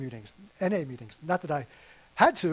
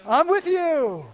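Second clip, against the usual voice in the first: slow, gently spoken and high; slow tender promise; 6 semitones higher, 3 dB louder, slower than usual. Acted. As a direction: say "I'm with you" slowly and lovingly. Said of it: "The Lord says".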